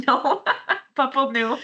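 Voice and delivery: high, amused laughing refusal. Retold or imitated. Colleague laughing at a French pupil's word usage, amused refusal. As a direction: laugh it off as a firm no.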